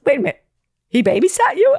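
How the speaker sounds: high, loud incredulous question; astonished disbelief. Retold or imitated. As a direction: imitate a stunned friend, pitch jumping up. Friend's astonished question on phone.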